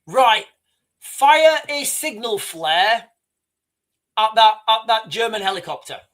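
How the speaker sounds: lightly acted captain giving a firing order; captain's order.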